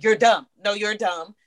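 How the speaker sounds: ordinary delivery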